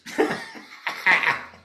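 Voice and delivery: high-pitched burst of laughter. Real, not acted. Preacher laughing at her own silly goose, high burst. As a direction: let a big high laugh out.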